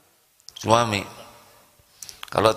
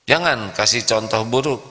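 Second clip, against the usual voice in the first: ordinary talk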